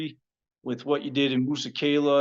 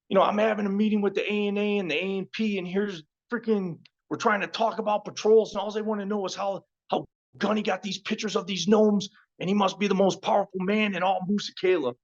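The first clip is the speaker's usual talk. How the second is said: higher-pitched, rushed retold captain; a hint of an exasperated boss; lightly voiced bewildered captain, raised pitch; 6 semitones higher than usual. Captain retelling a baffling meeting.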